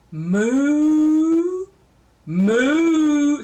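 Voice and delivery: long, loud, high moos; cow moo. Retold or imitated. Grandma teaching what a cow says, mooing loudly. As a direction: moo with a big upward slide. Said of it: "And a cow goes".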